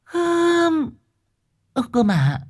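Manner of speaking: put-on high-pitched fussing old woman; old wife calling out. Old wife calling after the car, high and fussing. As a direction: scold them as they drive off. Linh grumbles at husband.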